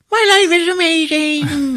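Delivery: savoring the money shower; blissful little alien. Retold or imitated. Imitating the little alien in a money shower, squealing with joy. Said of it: "And he's like".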